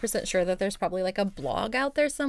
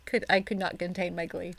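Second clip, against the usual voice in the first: ordinary talk, nothing marked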